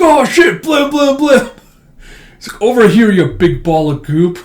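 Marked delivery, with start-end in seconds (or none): 0.0-1.5 very high, loud comic panic; panicked yelp. Retold or imitated. Someone faced with a hungry monster, comically panicked. 2.6-4.4 raised, loud challenge; taunting call-out. Retold or imitated. Hero draws the monster away, shouting a taunt.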